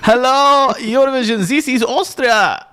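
high, hammy Austrian announcer; comic Austrian accent, slow stretched country name. Acted. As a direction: do a high-pitched Austrian points presenter. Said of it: "right, now do Austria".

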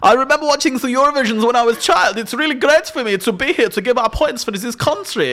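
high, put-on German accent; hammy Eurovision presenter with German accent; German-accented spokesperson. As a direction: gush in a comic German accent. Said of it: "They give us a, their life story".

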